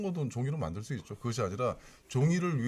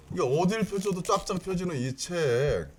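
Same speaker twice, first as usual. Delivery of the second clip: infomercial host; 7 semitones higher than usual. Pretend. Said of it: "홈쇼핑처럼 해도 될까요?".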